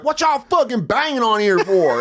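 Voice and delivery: high, loud challenge at the door; belligerent Andy impression. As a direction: imitate a tough kid demanding answers. Imitating Andy answering the door.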